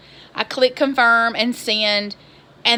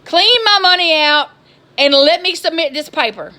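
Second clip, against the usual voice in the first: loud, high exasperated rant; fed-up caller yelling about cookies; retold angry outburst; 5 semitones higher, 6 dB louder than usual. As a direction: let the frustration blow up at the help desk. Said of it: "And I'm just like".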